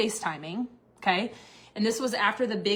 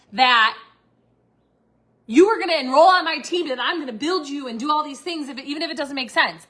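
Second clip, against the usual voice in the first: retold angry rant, raised in pitch and volume; indignant confrontation; 4 semitones higher, 6 dB louder than usual. Confronting Jennifer for lying to her, indignant. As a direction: build to a loud, high accusation.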